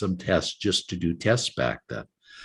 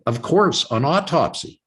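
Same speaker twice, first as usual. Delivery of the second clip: the neurologist's reply pitched high and louder, matter-of-fact; a hint of a callous neurologist, higher and louder; blunt doctor; 7 semitones higher, 5 dB louder than usual. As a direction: toss it off like a cold specialist.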